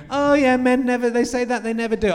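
mocking voice. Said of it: "they go".

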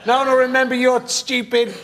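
mock villain rant, pitched high; wild unhinged ranting. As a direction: rant like a spurned genius vowing revenge. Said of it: "Oh, God".

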